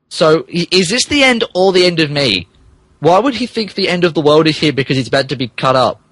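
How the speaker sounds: ordinary delivery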